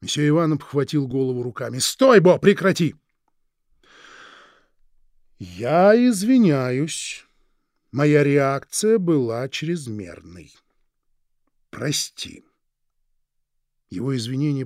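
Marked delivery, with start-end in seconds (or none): 1.8-2.9 loud. Acted. Teacher stops the crying boy. 5.4-12.4 warm. Acted. Teacher apologises after the outburst.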